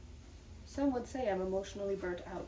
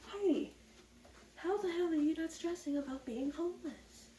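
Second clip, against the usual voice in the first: high incredulous viewer voice; imagined viewer; 8 semitones higher than usual. Pretend. A viewer asking her in disbelief, high and incredulous. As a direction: squeak it out like a baffled fan. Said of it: "And you might be wondering".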